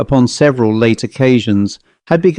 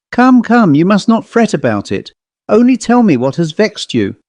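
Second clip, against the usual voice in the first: higher, gently urging; coaxing mistress; 8 semitones higher than usual. A narrator's reading. Lady coaxing her maid, gentle and higher. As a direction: coax her to speak.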